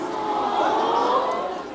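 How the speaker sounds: high, startled "What?"; surprised. Retold or imitated. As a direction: caught off guard by Pedro's answer, squeaks "What?". Surprised reaction to answer.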